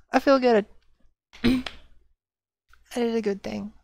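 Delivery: normal talking voice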